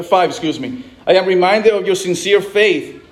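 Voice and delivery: usual speaking voice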